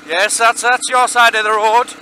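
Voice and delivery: sarcastic. Real, not acted. Sarcastic at an oncoming driver.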